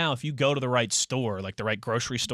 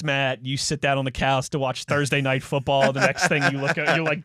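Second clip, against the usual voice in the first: ordinary talk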